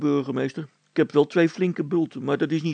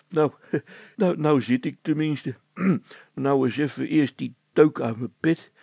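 ordinary delivery, nothing marked

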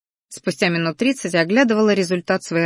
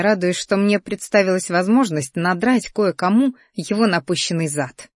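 usual speaking voice

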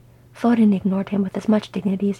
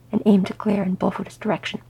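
ordinary delivery, nothing marked

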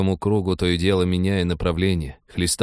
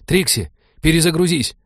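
high, loud sudden shout; sudden yell; 10 semitones higher, 5 dB louder than usual. A narrator's reading. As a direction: yell the order out of nowhere. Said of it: "неожиданно заорал кибертехнолог".

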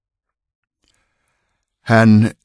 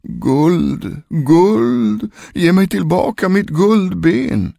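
high, long-drawn wail on one note; ghostly calling voice; 12 semitones higher, 4 dB louder, much slower than usual. A narrator's reading. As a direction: wail it from beyond the grave. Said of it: "rösten ropade ner från graven". (klagande, utdraget spökrop)

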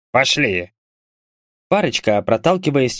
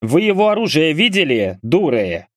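higher-pitched, mildly scornful question; scornful snort; 6 semitones higher than usual. A narrator's reading. Burly man putting the girls in their place, scornful. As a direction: scoff at them, dropping on the last word. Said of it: "фыркнул мужчина".

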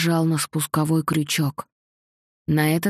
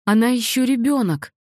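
higher, louder desperate plea; pleading mother; 5 semitones higher, 4 dB louder than usual. A narrator's reading. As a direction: beg to be taken instead. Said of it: "взмолилась мама".

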